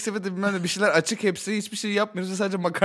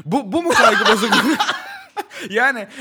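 laughing; 4 semitones higher, 4 dB louder than usual. Real, not acted. Laughing while arguing.

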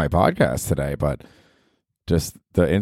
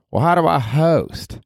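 Ruth from Ozark; 8 semitones higher, slower than usual. Acted. Podcaster voicing Ruth, cursing at him, brash and high. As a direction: snap it like a foul-mouthed hillbilly girl.

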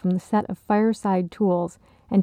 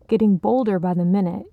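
ordinary delivery

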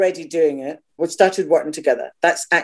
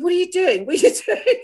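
high, incredulous questioning; squeaky mock alarm, breaking into a laugh; bewildered; 13 semitones higher than usual. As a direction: imitate a friend baffled on stage. Retold bewildered question on stage.

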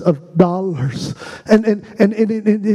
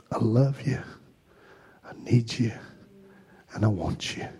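soft worship prayer; 7 semitones lower, 9 dB quieter than usual. Acted. Worship leader murmuring a prayer to Jesus, hushed. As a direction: breathe it to God, barely there.

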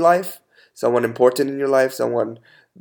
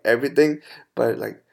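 usual speaking voice

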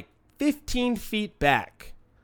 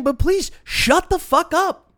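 high-pitched fed-up plea; exasperated; 10 semitones higher than usual. Retold or imitated. Dog owner fed up with hecklers, exasperated.

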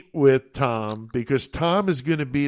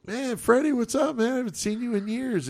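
high-pitched, friendly retold hello; surprised greeting; 9 semitones higher, 4 dB quieter than usual. Running into old friend Freddie at the mall, surprised greeting. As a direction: greet a long-lost pal in a high voice.

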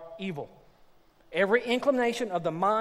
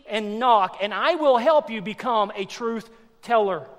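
loud, raised, driving exhortation; full-force preaching; 6 semitones higher, 8 dB louder than usual. Acted. Preacher urging struggling Christians to ask, full-force preaching. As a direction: press it home loudly, high-pitched and urgent.

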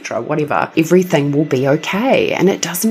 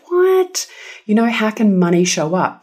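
sudden high disbelieving "what"; mock skeptical listener, pitch jumping up; incredulous; 6 semitones higher than usual. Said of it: "they'll think".

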